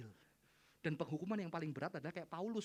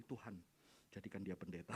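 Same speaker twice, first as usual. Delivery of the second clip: mock whispered prayer; 11 semitones lower, 8 dB quieter than usual. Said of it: "Doakan ya".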